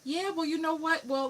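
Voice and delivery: ordinary talk, nothing marked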